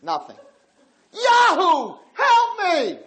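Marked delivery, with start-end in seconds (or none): 1.1-3.0 high, loud frantic cry for help; desperate scream. Retold or imitated. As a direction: shriek at the idol to save you. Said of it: "started to scream the name".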